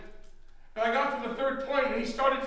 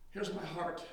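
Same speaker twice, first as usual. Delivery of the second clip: much softer, lower, intimate; quiet confession; 7 semitones lower, 12 dB quieter than usual. Shown as a demonstration. Preacher showing how to open one's heart, soft and low. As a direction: offer it gently, almost private.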